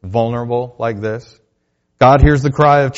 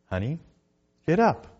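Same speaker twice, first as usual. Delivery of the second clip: imitating his little daughter; 4 semitones higher, 5 dB quieter than usual. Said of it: "she says".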